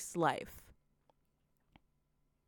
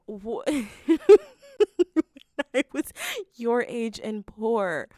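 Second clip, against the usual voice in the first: laughing disbelief; 5 semitones higher, 11 dB louder than usual. Real, not acted. Rereading the boss's line to viewers, still laughing. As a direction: repeat it, still cracking up. Quoting text while laughing.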